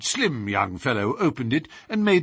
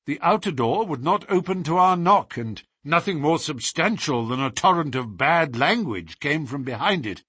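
ordinary delivery, nothing marked

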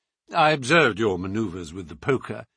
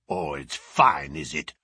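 deep sneering bruiser growl; 8 semitones lower than usual. A narrator's reading. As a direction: throw his words back with a growl. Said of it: "growled the savage".

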